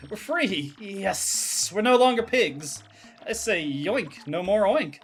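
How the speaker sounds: lifted, joyful cry of relief; hammed-up elation; jubilant villager. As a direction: celebrate sudden freedom. Freed pig villager celebrates.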